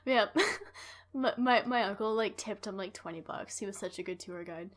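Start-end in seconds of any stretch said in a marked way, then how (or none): none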